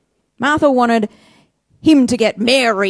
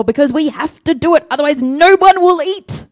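mock panic; 6 semitones higher, 7 dB louder than usual. Mocking Martha's frantic excuse.

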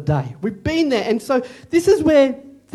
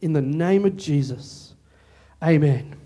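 hushed prayer amen; 2 semitones lower, 3 dB quieter than usual. Real, not acted. Closing prayer.

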